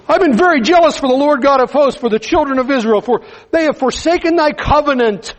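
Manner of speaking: high, pleading lament; complaining prophet. Acted. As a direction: whine it out like a worn-out prophet. Elijah complains to God.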